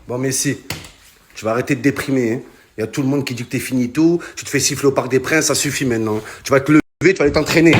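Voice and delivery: low, stern scolding coach; stern pep talk. Pretend. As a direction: tell him off to get moving. Scolding Messi to get up.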